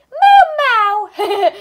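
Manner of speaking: high little cartoon meow; mock Gary meowing; snail meow. Child voicing the snail Gary.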